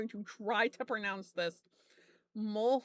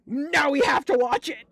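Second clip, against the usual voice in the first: mock insistence; 3 semitones higher, 8 dB louder than usual. Retold group insisting after gasp.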